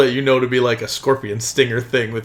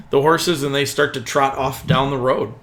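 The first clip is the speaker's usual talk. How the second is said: usual speaking voice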